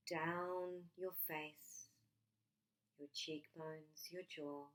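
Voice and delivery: ordinary delivery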